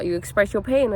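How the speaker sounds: ordinary talk, nothing marked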